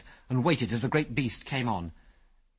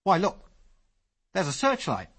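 higher, louder excited call; excited cry; 8 semitones higher, 3 dB louder than usual. A narrator's reading. Ginger spotting lights in the sky, excited cry. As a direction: point it out with sudden excitement. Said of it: "he cried".

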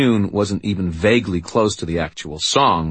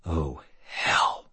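softly breathed curse; 5 dB quieter than usual. Acted. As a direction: exhale the words under breath. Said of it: "I breathed".

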